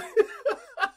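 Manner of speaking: high burst of laughter. Real, not acted. Host laughing at the guest's reaction, high laugh. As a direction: crack up at the guest.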